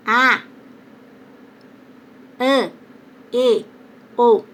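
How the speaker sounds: "an, e, o" fechados, o "an" nasal; vogais fechadas. Shown as a demonstration. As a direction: recite "an, e, o" separadamente, fechando a boca. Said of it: "o acento circunflexo é fechadas". ("an, e, o" em som fechado, pausado)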